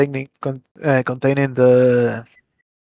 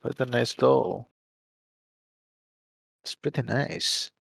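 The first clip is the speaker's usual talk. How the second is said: soft, kind reassurance; gentle smile; 10 dB quieter than usual. Acted. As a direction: quietly humor the child. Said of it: "I give her a smile as I say".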